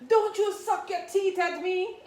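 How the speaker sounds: high, loud, laughing scold; mock a mother's scolding through laughter; laughing mom quote. Quoting her mom, laughing.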